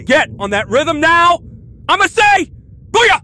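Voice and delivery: high, loud hype shout; hype yell. Real, not acted. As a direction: pump up the crowd with a shout. Hype celebration chant.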